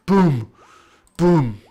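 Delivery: loud, punched crash imitation; booming impact. Game master voicing a tree stump crashing down, booming. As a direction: land each word like a heavy blow.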